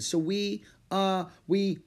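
drawn-out, uncertain fumbling; hesitant stalling. A narrator's reading. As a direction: stumble while inventing an excuse. Child improvising a cover story.

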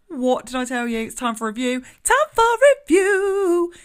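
loud, high, excited I-told-you-so; real gleeful vindication, building to a whoop; triumphant crowing. Real, not acted. Triumphant at card confirming her theme.

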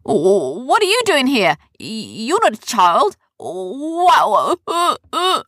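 loud, high, stammering fright; startled yelping and gasping. A narrator's reading. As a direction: recoil in alarm from a creature.

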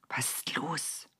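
leises, tonloses Flüstern; vorsichtiges Flüstern. A narrator's reading. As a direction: frag vorsichtig flüsternd nach. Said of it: "fragte einer der Engel vorsichtig". (ein Engel fragt Gott vorsichtig flüsternd)

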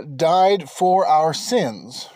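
ordinary delivery

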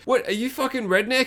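high-pitched mock taunt; mock incredulous. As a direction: imitate someone asking in disbelief. Acts out taunting in film.

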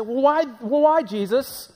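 high, loud indignant whine; indignant Pharisees. Retold or imitated. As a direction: demand an answer, offended. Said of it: "We'll say".

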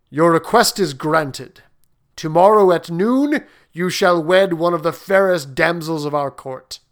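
loud, commanding king; kingly voice. Acted. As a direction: grant it with royal authority. King grants the prince's request.

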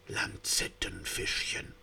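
awed whisper. Acted. One fish whispering as the lancelets pass by. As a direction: breathe the name in reverence. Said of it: "flüsterte einer".

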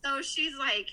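usual speaking voice

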